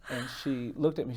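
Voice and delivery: ordinary delivery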